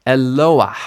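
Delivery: e-LO-ah, loud beat on "lo"; penultimate stress. Shown as a demonstration. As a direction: stress the "lo" in Eloah. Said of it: "it moves to the penultimate position".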